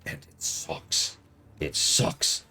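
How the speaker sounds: slow, soft whisper of disgust. Real, not acted. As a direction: breathe the verdict out with no voice. Disgusted verdict on the game.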